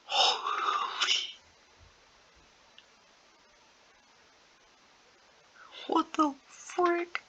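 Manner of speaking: high, slow stunned disbelief; gasping in shock at the noise outside; real startled reaction, drawn out high. Real, not acted. Reacting to neighbor's scream.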